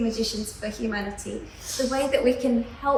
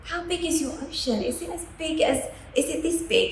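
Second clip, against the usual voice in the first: higher-pitched, wondering questions; amazed little frog; 6 semitones higher than usual. Acted. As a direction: play a naive frog marvelling. Well frog amazed by ocean.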